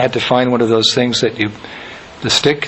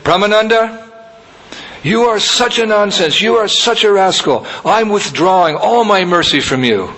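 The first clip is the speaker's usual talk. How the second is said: high, heated rebuke; angry guru scolding; 8 semitones higher than usual. Retold or imitated. Guru scolding his disciple Brahmananda, high and angry. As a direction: scold the disciple sharply, pitched up. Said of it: "He became so angry".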